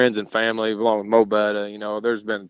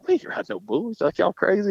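imitated boss scoffing, pitch raised; mock incredulous; 10 semitones higher than usual. Boss calling off the bull ride, mock incredulous. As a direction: scoff at their crazy idea in a higher voice. Acted boss dismissing the dare.

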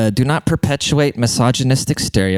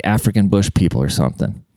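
ordinary talk

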